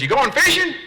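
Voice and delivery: high, mocking voice of the gas station guy; high mock attendant. Comedian mimics gas station clerk asking, high mocking voice. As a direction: ape the clerk's question way up high. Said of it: "He goes".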